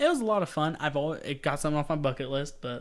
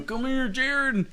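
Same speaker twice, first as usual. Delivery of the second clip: slow, high put-on accent calling Jared; a cheeky accent impersonating the center; Joel Embiid impression; 10 semitones higher, slower than usual. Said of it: "that's really bad".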